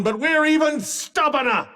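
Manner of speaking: high, rousing defiance; defiant boast. Acted. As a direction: throw it proudly at the enemy.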